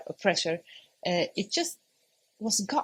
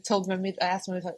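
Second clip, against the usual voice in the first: ordinary delivery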